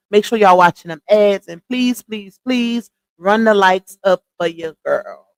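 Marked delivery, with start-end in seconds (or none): none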